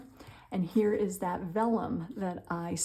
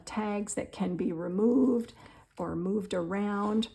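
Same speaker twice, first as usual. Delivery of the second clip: usual speaking voice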